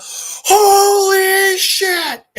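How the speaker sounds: high, loud, long-drawn yell; hammed-up shriek of astonishment; screamed amazement. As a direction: scream it out, stretching the first word. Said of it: "Chris just screams out".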